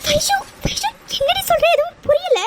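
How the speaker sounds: frightened friend begging for answers; anxious rising plea; alarmed. Acted. Friend panics at her crying.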